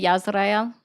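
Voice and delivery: "Yisrael" said the Hebrew way, with a Y sound and the R rolled; Hebrew pronunciation. Shown as a demonstration. Friend mocks the other over foreign names, saying "Yisrael" in Hebrew. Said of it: "if I say Yisrael".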